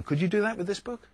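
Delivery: ordinary talk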